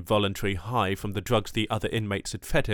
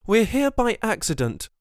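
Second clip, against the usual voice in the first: higher, hurried cut-in; quick interjection; 13 semitones higher, 3 dB louder than usual. Acted. Wyrick cuts in quickly to the captor. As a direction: jump in before he says more. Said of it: "Wyrick quickly interjected".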